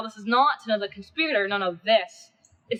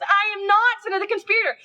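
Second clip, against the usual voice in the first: higher and louder, desperately insisting; panicked plea; 10 semitones higher, 4 dB louder than usual. Acted. Cinna begging the mob he's only the poet, panicked. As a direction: cry out your innocence to the crowd.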